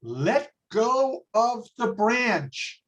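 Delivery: slow, stretched-out command from heaven; grand, deliberate divine voice; God. Acted. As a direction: pronounce it solemnly, word by word. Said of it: "So God says".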